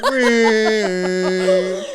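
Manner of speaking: high, loud mock roar; playful loud roar; mock roid rage roar. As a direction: roar like a raging gym guy. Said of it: "He's like".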